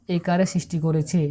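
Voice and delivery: ordinary talk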